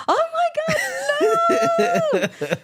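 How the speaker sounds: high-pitched retold shriek of horror; hammed-up horrified squeal; freaking out. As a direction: relive the panic in a wailing high cry. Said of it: "So I'm freaking out".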